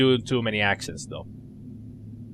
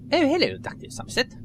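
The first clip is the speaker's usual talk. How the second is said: put-on higher, quick nerdy young woman; nerdy girl voice; 10 semitones higher, faster than usual. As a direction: play her as an eager nerd. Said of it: "I gave her a little nerdy voice".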